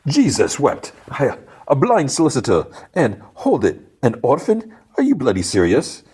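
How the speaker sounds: high, scoffing villain voice; incredulous gang boss. Retold or imitated. Gang boss hears the lawyer is an orphan, high and incredulous.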